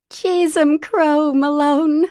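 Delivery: high, loud, slow squeak. A narrator's reading. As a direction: squeak it out in shock. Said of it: "Maggie squeaked".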